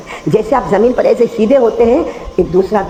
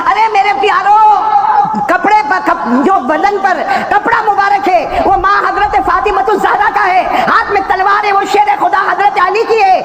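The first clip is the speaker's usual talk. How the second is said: preaching at full force; 13 semitones higher than usual. Acted. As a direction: cry it out to the crowd.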